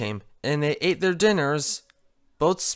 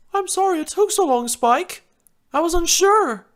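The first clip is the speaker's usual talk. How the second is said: much higher apologetic Rarity; 13 semitones higher than usual. Acted. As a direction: say sorry up high. Rarity voiced.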